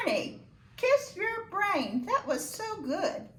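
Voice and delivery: high-pitched, enthusiastic praise; delighted praise. Real, not acted. Teacher praising a pupil.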